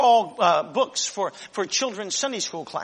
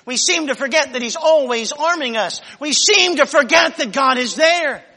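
ordinary talk, nothing marked

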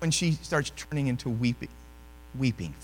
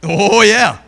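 ordinary talk

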